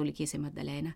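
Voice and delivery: ordinary delivery, nothing marked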